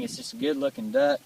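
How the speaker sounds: ordinary talk, nothing marked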